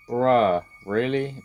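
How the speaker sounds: loud, high disbelieving bruh; loud exasperated. Real, not acted. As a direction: shout it in disbelief. Monster reappears.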